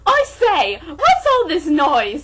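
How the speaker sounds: put-on high, loud, slow old butler; fussy old butler. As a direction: bustle in as a startled butler. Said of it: "said Watari".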